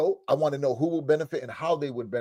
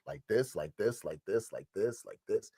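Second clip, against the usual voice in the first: quiet pained imagining; 5 semitones lower, 8 dB quieter than usual. Retold or imitated. Imagining their loss.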